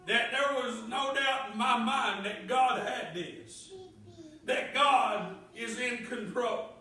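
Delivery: loud, ringing preacher voice, pressing each line home; full-force preaching. Acted. Preacher testifying God's control to church, loud preaching. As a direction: preach it out, building to a shout.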